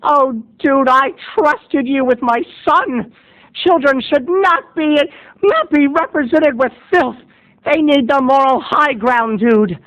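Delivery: high, slow pained lecture; disappointed mother. Retold or imitated. Mother reproaching the babysitter, high and slow. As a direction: lecture him with wounded disappointment. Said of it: "said Susan".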